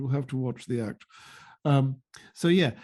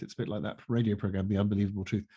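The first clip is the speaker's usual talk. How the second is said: ordinary delivery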